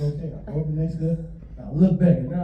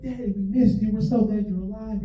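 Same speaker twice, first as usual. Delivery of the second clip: higher-pitched mock-gushing reunion cry; imitating excited kids; 9 semitones higher, 4 dB louder than usual. Kids welcoming dad home from danger, imitated with excitement. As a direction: play overjoyed children greeting their dad. Imagined kids greeting returning father.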